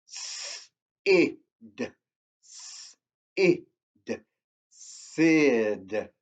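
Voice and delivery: "Sid" whispered twice, then spoken aloud; hushed "Sid, Sid", then full "Sid"; sounding out a word. Shown as a demonstration. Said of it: "The next word is Sid".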